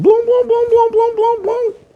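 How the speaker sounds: very high booming-syllable gulps; cartoon gobbling noise.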